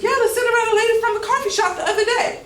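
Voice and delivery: high, fast, laughing and excited; big emphatic delivery. Shown as a demonstration. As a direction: throw it out bright and over the top. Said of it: "So put more emphasis".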